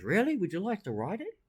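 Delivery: high, eager mock reply; widow's offer. Widow answering the bloke, high and eager. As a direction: perk up high, taking it innocently. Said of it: "She goes".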